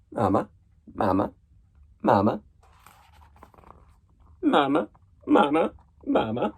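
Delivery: repeated rising little mama; goslings calling. Acted. Reader voicing goslings chanting mama at grumpy Bruce. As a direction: pester him with baby calls.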